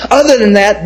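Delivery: a real laugh that tips into tears; laugh breaking into crying. Real, not acted. Recalling the boy mocked for his Bible, laughing then crying. Remembering being mocked at school.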